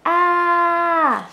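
sudden scared high shout of ah. Shown as a demonstration. Teacher demonstrating the scared letter sound, a sudden high yell. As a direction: jump back scared and yell ah. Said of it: "Or screaming a little bit".

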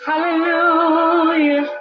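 ordinary delivery

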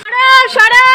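very high, sharp call to move; shouting. Real, not acted. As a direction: shoo them away loudly. Shooing someone out of frame.

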